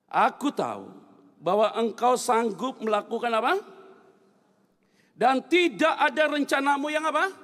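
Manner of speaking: loud, measured congregational reading; leading scripture reading. Real, not acted. Preacher leading the congregation through a verse, loud declaiming. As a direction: proclaim the verse loudly, lifting the final question word.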